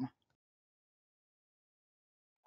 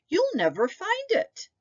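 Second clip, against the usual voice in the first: much higher grandmother voice, cautioning; warning. A narrator's reading. Baba warning Nicky the mitten will be lost, cautioning. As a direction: warn him like a fussing grandmother. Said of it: "she warned".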